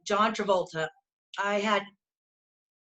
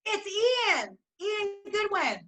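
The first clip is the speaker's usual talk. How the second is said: high-pitched, delighted gushing; real fond excitement; 9 semitones higher than usual. Real, not acted. Friend spotting Ian on the fan video, delighted gushing. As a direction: gush over someone you adore, voice up high.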